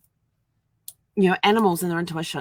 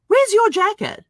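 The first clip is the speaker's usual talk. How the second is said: much higher, louder mock-concerned question; imitating the older neighbor; 10 dB louder, slower than usual. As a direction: play the fussing old man. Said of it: "he goes".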